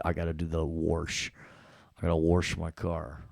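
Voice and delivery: New York "wash" said as "warsh"; New York accent. Shown as a demonstration. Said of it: "my New York accent, to put R's".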